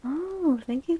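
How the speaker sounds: usual speaking voice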